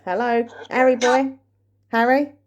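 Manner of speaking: calling a name into the room. Real, not acted. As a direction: call out, asking. Calling out to a spirit.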